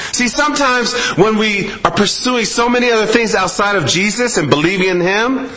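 usual speaking voice

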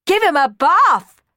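high, loud, slow eager yell. Acted. Hughie and Tina urging Rowan, loud eager yell. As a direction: shout it out excitedly. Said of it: "yelled Hughie and Tina".

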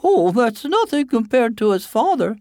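high-pitched storybook mother; boy's mother. Acted. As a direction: brush off the compliment in a high voice. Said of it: "said the boy's mother".

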